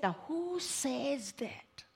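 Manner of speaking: hushed, slow whispered question. Retold or imitated. Counselor probing a client, whispered slowly. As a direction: breathe the question like a secret. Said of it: "So I said".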